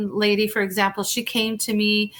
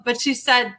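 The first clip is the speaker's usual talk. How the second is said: normal talking voice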